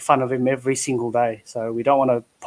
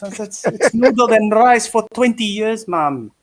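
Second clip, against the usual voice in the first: higher-pitched, slightly Filipino-sounding son; Filipino-sounding accent; 7 semitones higher, 3 dB louder than usual. Acted. Scene: son complains to Mom about noodles, light Filipino-sounding accent. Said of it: "why do you sound Filipino?".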